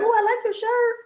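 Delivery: high sweet compliment to a lady; bright compliment voice. Retold or imitated. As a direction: chirp it like a cheery stranger. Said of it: "I was like".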